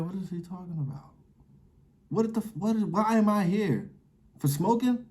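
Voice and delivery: put-on lost kid asking why he's even there; bewildered teen. Boy in the meeting asking his mom, high and bewildered. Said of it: "I'm looking at my mom like".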